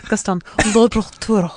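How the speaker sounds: choked Gaston-style French accent. Shown as a demonstration. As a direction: strain the words out like choking. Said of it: "I had to pretend I'm from, like, the Gaston".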